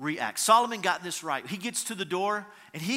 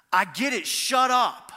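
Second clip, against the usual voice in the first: re-enacted loud snap, rising on the last words; loud, exasperated mock outburst; retold angry outburst; 4 semitones higher, 6 dB louder than usual. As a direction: act out losing your temper in an argument. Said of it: "this is how I reacted".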